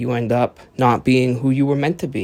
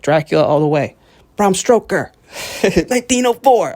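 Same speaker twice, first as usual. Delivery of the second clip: much higher, loud put-on Transylvanian voice; mock-proud Romanian bragging; 8 semitones higher, 5 dB louder than usual. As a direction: ham up a proud local boasting of Dracula.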